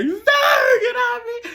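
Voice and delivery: hammed-up high, tearful shriek; mock crying scream. Shown as a demonstration. Showing how she was not crying, mock hysterical scream. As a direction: wail it loud and very high, overdoing the distress. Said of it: "And I'm not, like, crying".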